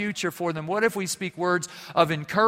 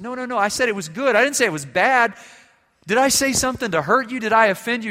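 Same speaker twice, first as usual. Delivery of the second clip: higher, louder and fast, urgently reassuring; alarmed reassurance; 9 semitones higher, 9 dB louder, faster than usual. Retold or imitated. A senator hurriedly reassuring a crying intern, high and loud. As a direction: rush in to stop the boy's tears.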